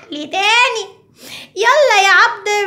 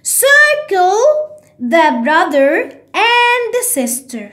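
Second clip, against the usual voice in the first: slow teacher read; much slower than usual. Shown as a demonstration. Teacher reads the exercise to pupils, very slow. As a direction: read the task out slowly. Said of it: "مكتوب إيه؟".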